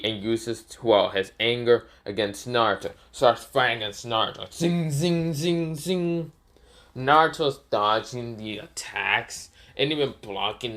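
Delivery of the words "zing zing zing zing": higher, voiced zing noises; vocal zing effects; lightsaber sound effects. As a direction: imitate saber swings.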